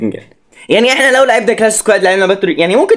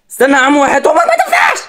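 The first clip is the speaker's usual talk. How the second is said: pleading yell; 8 semitones higher, faster than usual. Real, not acted. Begging teammate in panic.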